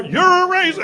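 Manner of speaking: high-pitched mock accusation; put-on high voice, accusing. Acting out the audience's accusation.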